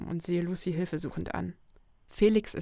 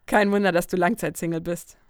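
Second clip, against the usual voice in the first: cheeky; 8 dB louder than usual. Acted. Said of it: "meine ich frech".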